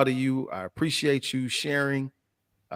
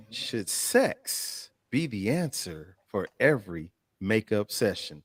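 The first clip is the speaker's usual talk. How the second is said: lower, softer, measured question; weighty, spaced-out delivery of the question; slow, deliberate repeat; 3 semitones lower, 5 dB quieter than usual. Real, not acted. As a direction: pose it slowly, phrase by phrase. Repeats question slowly for effect.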